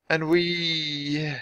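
drawn-out, suspended last word; trailing into a pause. A narrator's reading. Jack struggling to explain himself, hesitant and drawn out. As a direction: stretch the word and hang there. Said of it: "he paused".

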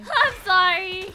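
high, drawn-out loud cry; loud apology. Real, not acted. Friend apologizing to the group, loud drawn-out cry. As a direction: belt out a long, slow sorry.

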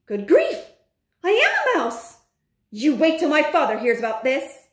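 high-pitched alarm turning to indignant threat; shocked outburst. Acted. Bruno seeing his hairy paws, shocked and indignant. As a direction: cry out in horrified discovery.

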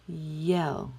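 "yell" held out as one syllable; isolated syllable. Shown as a demonstration. Teacher giving the first syllable, "yell" held. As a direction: say "yell" alone, drawn out. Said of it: "The first syllable is".

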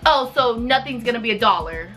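ordinary talk, nothing marked

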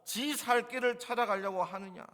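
high, loud, indignant scolding; master's stern rebuke. Acted. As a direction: reprimand a servant, hammering out each charge. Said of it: "훈계한 말입니다".

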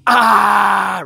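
the scream you let out alone in the woods; primal scream. Pretend. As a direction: let it all out in one loud cry. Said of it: "go to the woods and scream".

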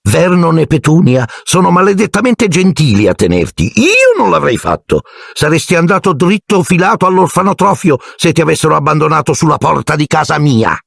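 very high, scornful aunt voice; high scornful lecture; Aunt Marge. A narrator's reading. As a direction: lecture the boy with contempt, pitched high.

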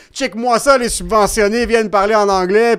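high, loud mock outrage; mocking online commenters. Commenters griping about subsidized comics speaking English, mocking outrage. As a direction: raise your voice as an indignant commenter. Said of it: "le monde, euh".

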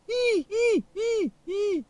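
squeaky falsetto "ee, ee" cackle; a manic high-pitched laugh; crazy high giggle. A narrator's reading. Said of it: "started to laugh his crazy laugh".

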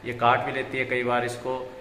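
ordinary delivery

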